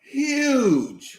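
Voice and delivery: high, drawn-out exclamation; excited emphasis. Real, not acted. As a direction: stretch the word high in awe. Echoing excitement over imagined movie.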